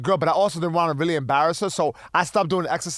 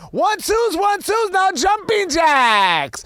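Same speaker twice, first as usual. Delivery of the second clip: mocking a bootcamp trainer, much higher and louder; hyped bootcamp trainer; 10 semitones higher, 5 dB louder, slower than usual. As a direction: shout the drill like a hyped-up fitness coach. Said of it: "and I put air quotes".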